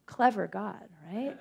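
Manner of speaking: ordinary talk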